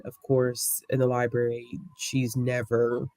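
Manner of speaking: normal talking voice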